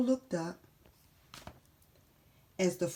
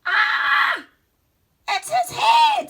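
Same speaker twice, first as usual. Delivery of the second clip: scared shriek; 13 dB louder, slower than usual. Acted. Fright at soup.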